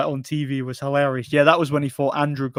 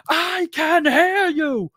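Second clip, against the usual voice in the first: much higher, slow mock yell of a trainer; imitating Uli Wegner; much slower than usual. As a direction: play the trainer snapping back at the official, stretched out. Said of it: "Uli Wegner just turns around to him and goes".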